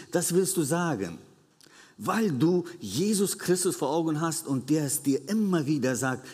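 usual speaking voice

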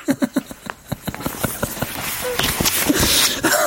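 high silent wheezing laugh; breathless laugh at the punchline. Real, not acted.

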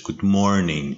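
"Good morning" with the weight on "morning"; stress on MORNING. Shown as a demonstration. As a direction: lean on "morning", letting it fall at the end. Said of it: "استرس جمله روی morning هستش". («Good morning» با تأکید روی morning)

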